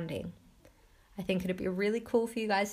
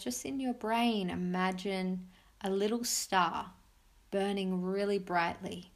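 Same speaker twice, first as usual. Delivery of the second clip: soft spa whisper; 3 dB quieter than usual. Acted. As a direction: guide them gently into calm. Leads a guided meditation.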